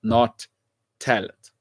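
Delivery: slow low mantra; slow emphatic. Real, not acted. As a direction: drag each word out like a motto. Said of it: "I'll say it again".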